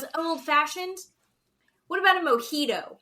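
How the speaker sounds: high, loud mock disbelief at the order; incredulous Brandon. Brandon to the roadside bar server, hammed-up incredulous question. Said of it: "And then Brandon goes".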